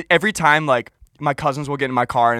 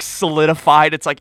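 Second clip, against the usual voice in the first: ordinary talk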